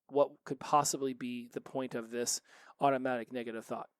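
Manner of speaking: ordinary talk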